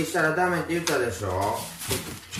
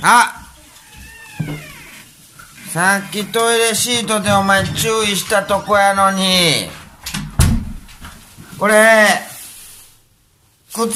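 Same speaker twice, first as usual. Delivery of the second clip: angry scolding; 5 semitones higher, 3 dB louder, slower than usual. Real, not acted. Owner scolding dog over shoes.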